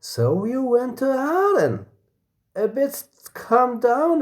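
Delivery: teasing question pitched high and slow; teasing friend. Acted. A F teasing a friend about Aden, high voice. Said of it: "A F says".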